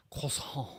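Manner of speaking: Croissant said the French way, kʰɔsɑ̃ with the final t dropped; soft French Croissant, trailing off. Shown as a demonstration. Pronouncing the pastry French to mock the ambassador job: Croissant in French. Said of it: "say the word croissant".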